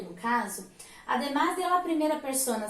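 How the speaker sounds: ordinary delivery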